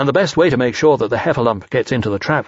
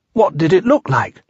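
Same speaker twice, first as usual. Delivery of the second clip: put-on higher-pitched boy's voice; Christopher Robin's question; 6 semitones higher, 3 dB louder than usual. Christopher Robin questioning frightened Piglet, higher curious voice. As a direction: ask it pitched up, as a boy.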